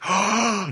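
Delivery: sharp delighted intake of breath; amazed gasp. Retold or imitated. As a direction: gasp at a shiny treasure. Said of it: "the way that your slime looks up at it".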